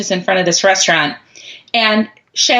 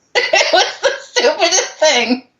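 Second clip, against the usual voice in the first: laughing; 11 semitones higher than usual. Real, not acted. Laughing at memory.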